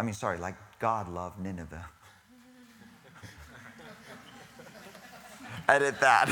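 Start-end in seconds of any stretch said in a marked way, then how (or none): 3.2-5.6 soft high laugh at his own slip. Real, not acted. 5.7-6.3 loud, through real laughter; laughing. Real, not acted. Laughing at his own slip.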